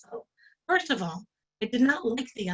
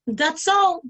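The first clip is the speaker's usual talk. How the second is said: high, louder dismissive that's all; curt; 7 semitones higher, 6 dB louder than usual. Retold or imitated. Cashier dismisses the angry customer. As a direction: brush him off.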